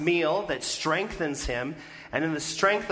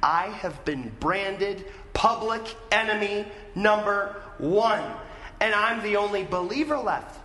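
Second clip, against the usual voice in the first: raised, emphatic pitch, landing hard on "one"; mock temper tantrum; 5 semitones higher than usual. Elijah complaining to God of being hunted, mock tantrum. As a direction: protest it word by word, aggrieved. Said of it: "like he's throwing a temper tantrum".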